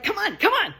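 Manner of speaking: high, pushy come on; high insistent urging; urgent high coaxing. Retold or imitated. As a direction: hurry me along, mock urgent. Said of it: "They're like".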